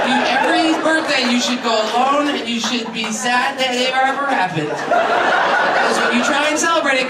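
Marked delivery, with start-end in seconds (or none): none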